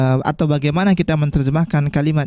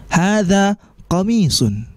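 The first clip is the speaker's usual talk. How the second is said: slow model reading; 5 semitones higher, slower than usual. Shown as a demonstration. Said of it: "maka kita jawab".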